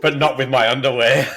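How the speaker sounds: laughing through the words. Real, not acted. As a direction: crack up while talking. Giggling after pun.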